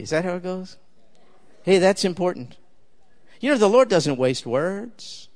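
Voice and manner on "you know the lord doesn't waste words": ordinary talk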